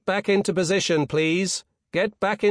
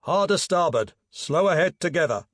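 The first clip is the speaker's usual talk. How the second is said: raised helm order; urgent command; 5 semitones higher, 5 dB louder than usual. A narrator's reading. Skipper calling helm orders.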